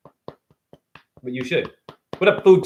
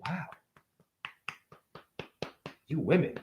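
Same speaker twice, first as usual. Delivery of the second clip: ordinary talk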